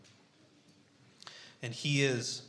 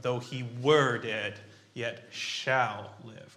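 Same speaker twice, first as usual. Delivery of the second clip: slow, with "were" and "shall" drawn out; stress on the verbs; 6 dB louder, much slower than usual. Shown as a demonstration. Preacher explaining what you're saying, heavy stress on "were" and "shall". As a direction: punch "were" loudly, then stretch "shall". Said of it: "be more of the verbs".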